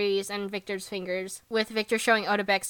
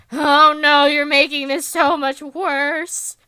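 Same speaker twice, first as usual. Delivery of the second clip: louder, slowed mock dismay; hammed-up embarrassed wail; mock mortified; 4 semitones higher, 6 dB louder, slower than usual. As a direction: groan it like a mortified friend. Yuri's mortified reaction retold.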